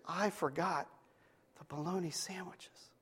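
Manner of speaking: soft, halting mock confession; feigned regret. Mom confessing to the little girl for effect, feigned regret. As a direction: let it trail away quietly, as if regretful.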